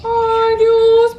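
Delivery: long, high, loud sung-out "Dios mío"; piercing drawn-out exclamation; loud wail. Real, not acted. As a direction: belt it out high and hold the last note. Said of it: "She's freaking loud in my ear".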